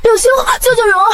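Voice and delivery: put-on high, sobbing little-girl plea; tearful pleading. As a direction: beg for help through tears. Girl tearfully begs cousin, told stop crying.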